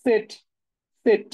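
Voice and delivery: সেট said as German Z, clear and drawn; German letter name. Shown as a demonstration. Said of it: "জেড এর যে উচ্চারণ সেটা হচ্ছে সেট". (German Z-এর মতো সেট উচ্চারণ)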